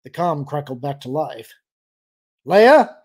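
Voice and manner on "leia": loud, high call rising at the end; raised voice, questioning lilt. A narrator's reading. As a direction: call out for a reply, voice lifting. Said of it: "Han called".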